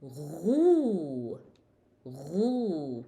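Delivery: "ghu" with rasped gh and long oo; gargled "ghu", vowel held; ghain with dhamma. Shown as a demonstration. Teacher shows ghin with dhamma, long ghu. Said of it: "Ghin with dhamma".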